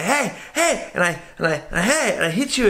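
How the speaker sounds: high-pitched mock yelps of hey; panicked accented roommate, acted out high; alarmed roommate imitation. Roommate yelling to stop sleepwalker.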